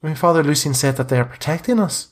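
a hint of a worried boy; lightly voiced anxious boy. Boy insists the bones protect them, anxious.

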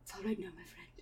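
very soft whispered reassurance; hushed comfort. Acted. Akira comforts little Andy.

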